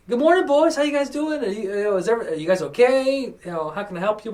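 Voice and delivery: high-pitched friendly greeting, imitating an older woman. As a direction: lift the voice high to greet as a kindly older lady.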